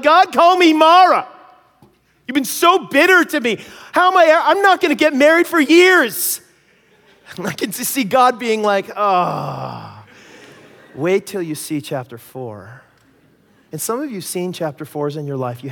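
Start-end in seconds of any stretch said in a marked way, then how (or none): 0.0-6.4 much higher, louder crying cry to God; retold anguished prayer. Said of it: "I'm crying my eyes out". 8.9-12.8 a hint of a knowing, patient God; lightly voiced calm God. God answering kindly.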